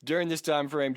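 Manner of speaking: ordinary talk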